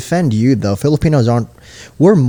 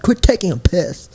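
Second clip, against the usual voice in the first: mock British, a bit higher; British slang; 6 semitones higher than usual. Shown as a demonstration. Showing a friend what British people say, mock British accent. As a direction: toss off a cheeky British line. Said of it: "Say that".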